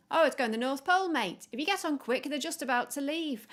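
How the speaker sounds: a brash gull shouting back; seagull voice. Acted. Seagull shouting directions to the penguins, brash and loud.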